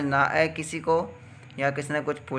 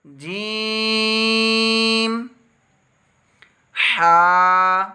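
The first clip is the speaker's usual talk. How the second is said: slow chant on a raised, steady note, each letter held; alphabet recitation; 5 semitones higher, 7 dB louder, much slower than usual. Shown as a demonstration. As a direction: recite each letter long and clear for repetition. (क़ारी की तरह हुरूफ़ खींचकर तिलावत)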